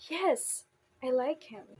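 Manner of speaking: strong falling emphasis on Yes and like; emphatic stress. Shown as a demonstration. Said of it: "to convey emotions".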